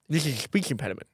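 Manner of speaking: mock-earnest lisped protest; put-on lisp. Prankster telling friend it is an impediment, lisping. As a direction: lisp heavily while insisting it is real.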